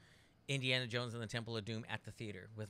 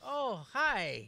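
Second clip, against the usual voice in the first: nervous fan; 8 semitones higher, 4 dB louder than usual. Retold or imitated. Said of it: "I got really nervous".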